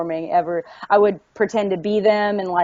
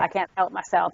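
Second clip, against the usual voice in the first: ordinary talk, nothing marked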